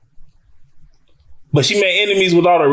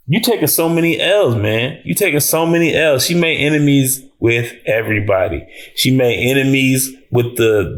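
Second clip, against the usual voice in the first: ordinary talk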